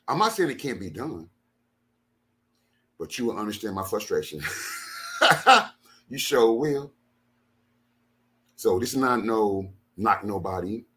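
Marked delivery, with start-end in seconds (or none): 4.5-5.7 a short unvoiced laugh up high; high scoffing chuckle; high breathy laugh. Real, not acted. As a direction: laugh off the frustration. Laughs after his challenge.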